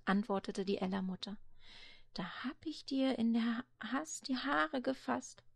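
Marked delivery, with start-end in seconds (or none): 2.1-5.4 soft, whispered excuse. Acted. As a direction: hush him back to sleep. Grandmother excusing herself softly.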